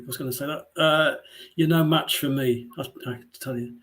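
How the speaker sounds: normal talking voice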